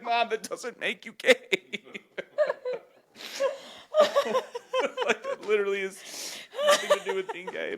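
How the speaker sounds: high, laughing exasperated protest. Retold or imitated. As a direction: giggle while correcting mom. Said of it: "I was like".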